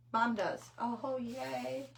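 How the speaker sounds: ordinary talk, nothing marked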